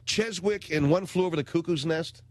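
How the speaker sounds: ordinary talk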